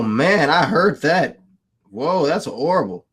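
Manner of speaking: ordinary talk, nothing marked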